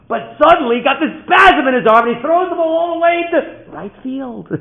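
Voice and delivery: very high, loud shouted narration; excited climax. A narrator's reading. Father relives the pitcher's deliberate misthrow, excited shout. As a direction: burst into the wild throw at full force. Story climax, wild throw.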